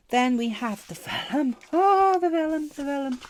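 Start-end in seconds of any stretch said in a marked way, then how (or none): 1.7-3.2 delighted gush. Real, not acted. Swooning over the vellum sheet.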